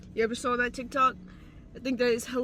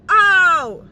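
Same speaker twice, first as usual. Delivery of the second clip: pained yelp; 4 semitones higher, 10 dB louder than usual. Real, not acted. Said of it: "Ow, ow, ow, ow".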